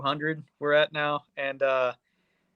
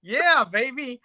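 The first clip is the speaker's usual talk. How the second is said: high, slow, delighted whoop; excited cheer; 10 semitones higher, 3 dB louder, slower than usual. Retold or imitated. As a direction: cheer it like a thrilled fan. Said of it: "I was like".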